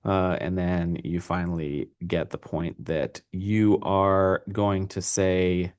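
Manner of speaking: ordinary delivery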